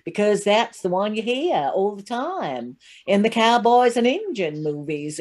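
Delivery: slow cowboy-movie American drawl; a Brit's exaggerated American twang, drawn out; put-on American accent. Shown as a demonstration. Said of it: "that's the accent we can all do".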